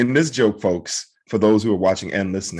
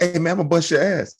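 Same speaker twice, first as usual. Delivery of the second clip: higher-pitched comic road-rage threat; mock tough threat; 8 semitones higher than usual. Cut-off driver threatening the other guy, mock tough. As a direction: play the offended driver, pitched up.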